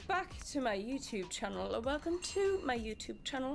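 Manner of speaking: put-on proper upper-class British accent, Crown style; posh British accent. Greeting viewers like royalty, posh British accent. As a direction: play a grand royal host in British RP. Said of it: "I can't keep up that accent".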